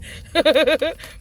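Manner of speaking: sudden loud laugh. Real, not acted. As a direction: burst of high laughter. Laughing at own joke.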